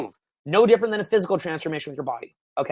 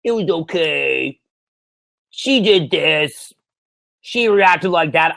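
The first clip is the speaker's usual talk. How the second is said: slow, dragged-out student whine; mock reluctant report, words stretched long; lacklustre student; much slower than usual. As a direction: imitate a deflated student, drawing out every phrase. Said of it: "they always come back and they're like".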